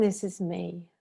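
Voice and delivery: "This is me" eased downward on the out-breath; breathing-out phrase. Shown as a demonstration. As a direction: let "This is me" sink as if breathing out. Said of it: "breathing out".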